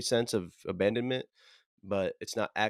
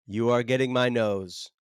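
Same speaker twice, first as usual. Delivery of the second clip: put-on clingy kid voice; 3 semitones higher, 4 dB louder than usual. Clingy kid demands at Christmas.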